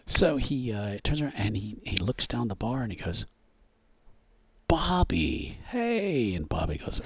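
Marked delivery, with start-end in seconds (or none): 4.7-6.3 high, drawn-out hail; showy, stretched-out greeting; loud talking guy. Acted. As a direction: call down the bar to an old acquaintance. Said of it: "you've seen this loud talking guy".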